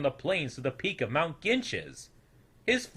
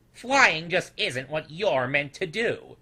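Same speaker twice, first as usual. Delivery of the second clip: put-on louder, knowing old voice; condescending elder; 4 semitones higher, 5 dB louder, slower than usual. A narrator's reading. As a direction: talk down to the youngster. Lou lecturing.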